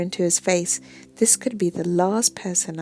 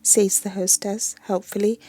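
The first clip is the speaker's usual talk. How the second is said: ordinary delivery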